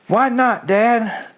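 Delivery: a hint of small eager foxes asking their dad; eager fox cubs. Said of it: "cried the small foxes".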